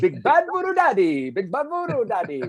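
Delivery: high, loud stage announcer; band introduction. Shown as a demonstration. Announcer calling the band onstage, high and loud. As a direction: welcome the band like a pro. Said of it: "complete gibberish".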